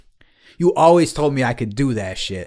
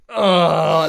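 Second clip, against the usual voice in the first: long, higher groan; imitated sick groan; 4 semitones higher than usual. Friend imitating his drunk friend after throwing up, sick groan. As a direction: groan like someone sprawled out sick. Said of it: "you were just like".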